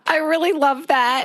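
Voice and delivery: moved almost to tears; getting emotional. Real, not acted. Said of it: "I'm getting emotional because it's true".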